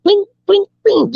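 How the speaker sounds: ping like an ever tighter string being plucked; plucked string imitation. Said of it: "they're plucking it with each page turn".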